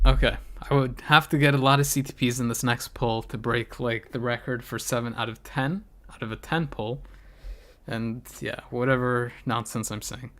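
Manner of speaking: ordinary delivery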